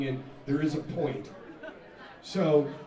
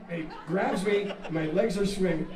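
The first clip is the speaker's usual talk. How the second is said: usual speaking voice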